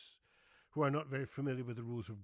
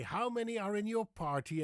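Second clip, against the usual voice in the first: host greeting; 9 semitones higher, 9 dB louder than usual. Retold or imitated. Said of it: "And they say".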